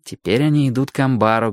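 plain reading voice

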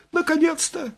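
very high-pitched head's voice, exclaiming; squeaky, eager exclamation; excited exclamation. A narrator's reading. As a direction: cry out in high falsetto at long last. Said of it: "воскликнула она".

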